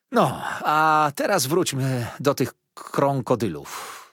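krąkodylów said with a broad ą, slow and stumbling; mangled word. Acted. Niania kaleczy słowo krąkodylów. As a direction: nurse fumbling the foreign word krąkodylów, slowly. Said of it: "nie mogąc sobie poradzić z tą niezwykłą nazwą".

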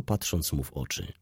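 usual speaking voice

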